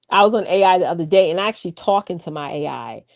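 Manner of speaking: usual speaking voice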